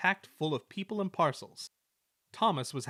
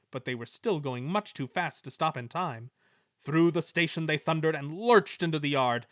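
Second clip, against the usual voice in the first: plain reading voice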